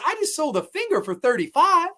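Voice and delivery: high-pitched imitation of an affronted seller; mock indignant. Orderly haggles over body parts, indignant. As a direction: protest it high, as if insulted.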